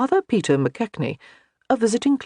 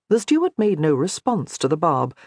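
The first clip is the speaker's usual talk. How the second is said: ordinary delivery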